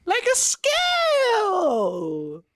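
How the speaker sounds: high, slow, triumphant wizard cry; hammed-up wizard shouting his answer; mock wizard exclamation. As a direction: proclaim it like a delighted old sorcerer. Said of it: "What is this accent?".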